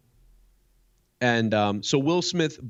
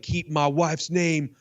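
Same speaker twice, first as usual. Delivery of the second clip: slow, higher menacing Will Smith warning; imitating Will Smith; 5 semitones higher, slower than usual. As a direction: lay down the threat slowly, pitched up. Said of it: "Will Smith was like".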